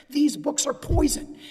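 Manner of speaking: loud, slow, emphatic condemnation; podium denunciation. Retold or imitated. Lawyer voicing officials at the podium, loud slow denunciation. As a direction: pronounce it like a public denunciation, word by word. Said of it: "stand at the podium and they say".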